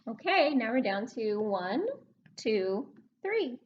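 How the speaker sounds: ordinary talk, nothing marked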